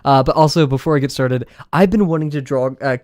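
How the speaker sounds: ordinary delivery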